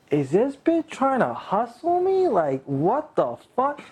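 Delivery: high-pitched, baffled disbelief; incredulous suspicion. Retold or imitated. Man retelling his suspicion of being hustled, incredulous. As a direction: question it as if you cannot believe it.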